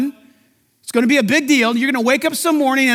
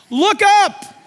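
emphatic exhortation; 7 semitones higher than usual. Real, not acted. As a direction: ring it out as a command. Repeated exhortation.

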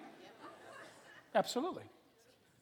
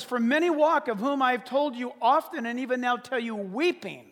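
loud, raised preaching delivery of a verse; preached scripture; 4 semitones higher, 10 dB louder than usual. Acted. Preacher reading Philippians to the congregation, loud and emphatic. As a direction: declaim the verse at full voice.

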